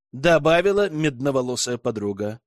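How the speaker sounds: ordinary delivery, nothing marked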